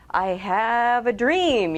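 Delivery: slow, drawn-out orator's proclamation; mock-oratory with a swelling voice; visionary speech. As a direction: stretch it out grandly, like a stirring speech. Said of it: "Do you see a vision in the world".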